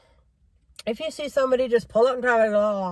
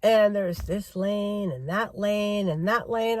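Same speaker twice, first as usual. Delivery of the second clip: ordinary talk, nothing marked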